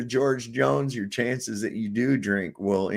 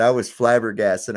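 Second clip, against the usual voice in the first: ordinary delivery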